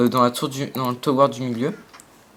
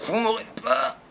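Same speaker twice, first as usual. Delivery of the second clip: higher, soft mocking taunt; 6 semitones higher, 6 dB quieter than usual. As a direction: tease them in a put-on voice. Taunting enemies in a put-on voice.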